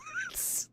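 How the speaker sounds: short laugh. Real, not acted. Friends posing for a photo.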